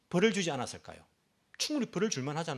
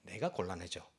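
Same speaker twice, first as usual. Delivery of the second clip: 곤란한 속삭임; 10 semitones lower, 7 dB quieter than usual. Shown as a demonstration. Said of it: "어떻게 말합니까?".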